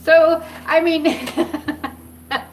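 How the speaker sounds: cracking up, words breaking into giggles; real laughter. Real, not acted. Vlogger laughing at her husband's reaction, real helpless giggles.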